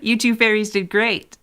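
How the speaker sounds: put-on high, bratty kid voice. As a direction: play a mischievous little kid. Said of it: "let me break out my new kid voice".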